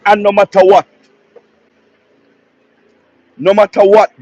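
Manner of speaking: loud, proud shout of a name; a fervent supporter shouting the leader's name. Real, not acted. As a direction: shout his name out with pride. Passionate praise of prime minister.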